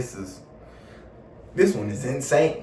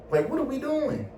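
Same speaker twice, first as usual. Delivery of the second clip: heated. Retold or imitated. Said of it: "starting to get a little heated".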